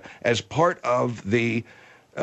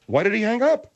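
higher, rising demand; indignant question; 7 semitones higher than usual. Acted. Host finds the caller has hung up, indignant question. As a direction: ask it pointedly, lifting at the end.

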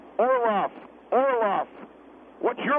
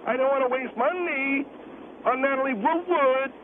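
put-on sing-songy naggy girl. Guest mocks what the caller wants, sing-songy and naggy. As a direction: parody a naggy little girl. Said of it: "sound like a sing-songy, naggy little girl".

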